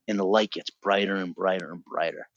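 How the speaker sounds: ordinary talk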